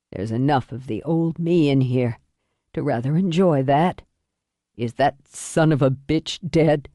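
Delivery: low, weary man's voice; dying father. Acted. Dying father asks about attacker.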